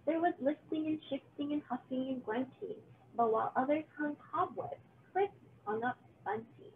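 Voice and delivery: plain reading voice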